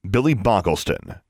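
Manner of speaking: ordinary delivery, nothing marked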